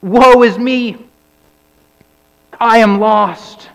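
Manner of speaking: loud anguished cry of woe. Acted. Preacher voicing Isaiah's cry before God, anguished and loud. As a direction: cry it out like a prophet undone. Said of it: "And he cries out".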